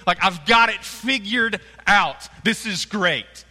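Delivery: high, loud know-it-all glee; smug excitement. Retold or imitated. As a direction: gush like you've cracked it. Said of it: "moments where you think".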